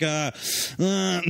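rising, vacant high uh sounds; mocking the clerk's sister as vacant; dim sister impression. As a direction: play a clueless girl stammering. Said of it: "She's like".

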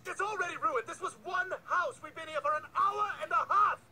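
high-pitched, mounting exasperation; exasperated rant. Acted. Fed up with partner after botched delivery, exasperated rant.

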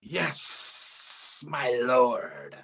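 long hissed S on "Yes"; obedient, hissing serpent; snake-faced demon. A narrator's reading. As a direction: hiss it out submissively. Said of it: "woman snake-faced demon named Slithotheus".

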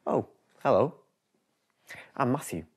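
usual speaking voice

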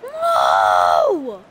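dismayed shout. Real, not acted. Chance missed in game.

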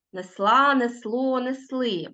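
несла, несло, несли stressed on final syllable; stress on endings. Shown as a demonstration. Teacher shows pupils ending stress, stressing last syllable of несла, несло, несли. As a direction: hit СЛА, СЛО, СЛИ in несла, несло, несли. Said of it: "наголос падає на закінчення".

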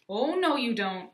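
loud, slow, drawn-out challenge; defiant shout. A narrator's reading. Aiden facing the cannon, defiant shout. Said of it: "Aiden shouted".

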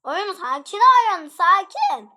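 loud, high, urgent appeal; agitated shout. Real, not acted. Player begs attackers to calm down, agitated shout. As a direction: shout for calm while anything but calm.

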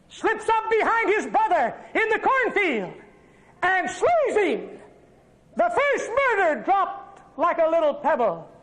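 high, dramatic sermon voice, slowing on slays; full-force preaching. Acted. Preacher telling of Cain slaying Abel, full-force preaching. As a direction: drive home the first murder.

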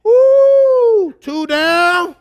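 high, loud, drawn-out whoop; hammed-up triumphant holler; celebrating husband. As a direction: cheer like a dad counting them off. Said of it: "And then it's like".

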